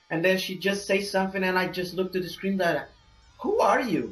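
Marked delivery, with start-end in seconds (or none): 3.4-4.1 high-pitched incredulous demand; mock bafflement. Moviegoer asking the screen about a forgotten character, mock-baffled. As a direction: act baffled, pitch swooping up.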